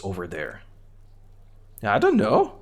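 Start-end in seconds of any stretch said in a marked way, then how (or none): 1.8-2.5 put-on high-pitched mouthy kid voice; Kuno voice. Kid Kuno mocks the cop, high bratty kid voice. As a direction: voice a rude little brat up high, talking about himself in third person. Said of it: "he shrugs".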